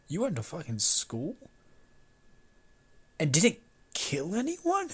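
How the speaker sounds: disbelief climbing to a high-pitched finish; mock-amazed questioning; incredulous question. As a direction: act astonished, voice shooting up at the end. Acted disbelief at Bakugo having schooling.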